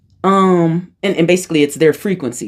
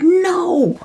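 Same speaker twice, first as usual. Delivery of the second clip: high, drawn-out cry of denial; disbelieving. Retold or imitated. Reacting to mom as spirit guide, disbelieving. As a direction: refuse it in a high voice. Retold refusal to accept the revelation.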